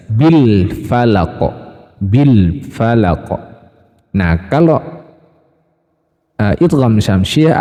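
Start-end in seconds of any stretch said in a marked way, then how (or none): 0.1-3.3 very slow bil falaq with a distinct l; slow model of bil falaq; clear lam. Shown as a demonstration. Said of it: "Lam sukunnya dibaca jelas".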